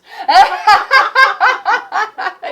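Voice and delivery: long bright burst of high laughter. Real, not acted. Guest laughing after making her point to host. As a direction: let a big happy laugh roll out.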